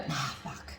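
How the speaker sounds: very soft breathy curse; hushed, defeated swear; whispered curse. Real, not acted. Wrap fails again.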